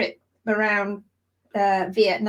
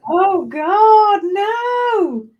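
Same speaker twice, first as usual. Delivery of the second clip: loud, high cry of dismay; 8 semitones higher, 8 dB louder, much slower than usual. Real, not acted. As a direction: wail it out in horror. Hearing about the 3 AM session.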